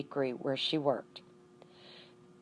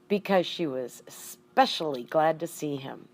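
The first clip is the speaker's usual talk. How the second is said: usual speaking voice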